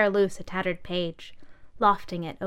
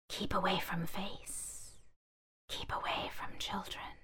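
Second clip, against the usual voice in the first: whispered warning; 12 dB quieter than usual. Acted. Warning label read.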